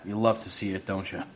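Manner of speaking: normal talking voice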